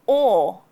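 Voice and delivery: "Or" loud with a rounded aw and no R; non-rhotic or. Shown as a demonstration. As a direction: say "Or" as a long aw. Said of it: "O is followed by an R".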